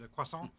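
"croissant" said kwah-SAHN, French-style; Frenchified "croissant" with no t; light French take on "croissant". Shown as a demonstration. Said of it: "either with my fake French accent".

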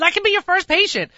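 high, loud eager volunteering; bright mock-eager pitch; playfully eager patient; eager offer. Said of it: "I said".

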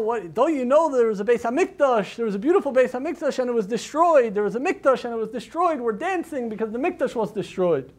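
hammed-up drunk revelers shouting at the wife; drunken shout. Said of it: "all begin to shout".

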